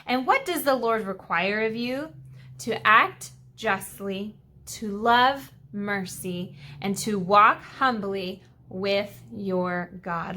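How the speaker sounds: slow and deliberate, each phrase given weight; slow, weighty reading. Shown as a demonstration. Teacher reading scripture slowly to the kids. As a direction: lay out each virtue slowly for the class. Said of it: "Let me read that again".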